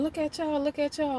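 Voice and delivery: put-on high teasing chant from the kids; mock the couple in a high singsong; teasing crowd. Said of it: "was screaming shit".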